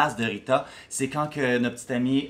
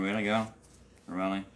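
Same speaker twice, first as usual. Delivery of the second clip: lightly voiced doubtful queen; a dry, unimpressed "really, girl?"; skeptical side-eye; 6 semitones lower, 6 dB quieter than usual. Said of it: "le petit side eye de Rita qui fait comme".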